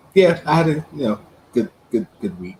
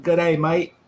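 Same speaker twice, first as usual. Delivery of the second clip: higher-pitched Aussie accent attempt; Australian accent; 7 semitones higher than usual. Shown as a demonstration. Host shows co-host the accent being tried, Australian accent. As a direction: try out a fun Australian accent. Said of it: "that's Australian, right?".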